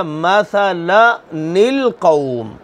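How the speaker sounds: very slow "मसलनिल कौम" with the nun carrying kasra; slow qari-style "मसलनिल कौम", alif left silent; drawn-out recitation. Shown as a demonstration. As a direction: chant "मसलनिल कौम" with each syllable held. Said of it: "क्या बन गया?".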